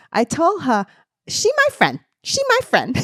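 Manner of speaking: put-on loud, high-pitched hardcore Jersey accent; exaggerated Jersey squawk, high and punchy; Kim's Jersey accent. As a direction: imitate a North Bergen friend staking her claim, loudly. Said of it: "that's so Jersey".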